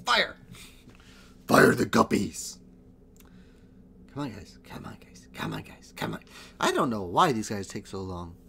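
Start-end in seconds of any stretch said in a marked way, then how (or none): 0.1-2.6 commanding shout. Real, not acted. Ordering his units to fire. 4.1-6.2 urgent whispered plea; whispering. Real, not acted. Urging teammates under his breath.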